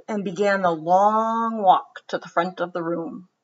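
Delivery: gentle storybook read-aloud; children's story narration. A narrator's reading. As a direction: read it like bedtime story time. Reads picture book aloud.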